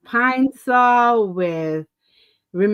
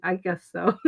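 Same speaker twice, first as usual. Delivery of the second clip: sheepish mumble; 5 semitones lower, 6 dB quieter than usual. Retold or imitated. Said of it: "That's all I answered him".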